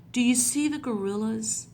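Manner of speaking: usual speaking voice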